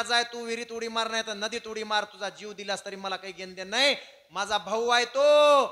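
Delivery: high, loud retort building to a shout; defiant quarrel. Acted. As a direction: argue back loudly, stretching the last words. Husband defies wife in quarrel.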